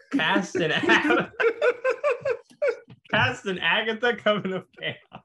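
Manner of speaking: breaking into laughter mid-joke; high, giggling through the words; laughing. Real, not acted. Cracks up at own joke.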